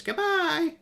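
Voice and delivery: tiny high voice, muffled and far off; muffled little voice. Acted. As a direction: squeak it out small and muffled. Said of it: "a very muffled little voice".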